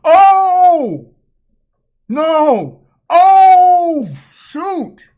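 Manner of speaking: loud, high drawn-out cries; alarmed shouting. Real, not acted. Viewer seeing the boy has the gun, alarmed shouting. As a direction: holler each word in alarm.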